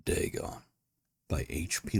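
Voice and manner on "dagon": usual speaking voice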